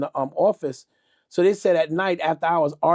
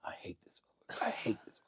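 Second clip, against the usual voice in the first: seethe under your breath, imitating a friend; whispered grumbling; 7 semitones lower, 16 dB quieter than usual. Fifty grumbles about the guy in the elevator, whispered. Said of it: "he's saying, the guy can hear him".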